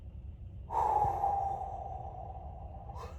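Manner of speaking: a long, weary exhale; heavy unvoiced sigh of frustration. Real, not acted. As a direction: let out a tired, fed-up breath. Long sigh at slow loading.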